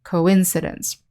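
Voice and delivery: "coincidence" with the stress on IN; co-IN-cidence, model the stress in "coincidence"; second-syllable stress. Shown as a demonstration. Teacher repeats the noun for learners, stressing IN in "coincidence". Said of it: "the stress is on the second syllable".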